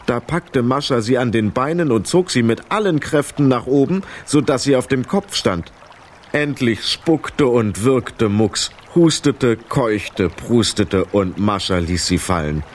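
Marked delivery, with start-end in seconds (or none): none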